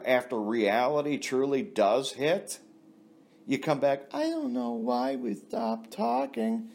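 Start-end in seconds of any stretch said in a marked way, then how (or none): 4.1-6.7 mock woman voice. Mocking returning woman.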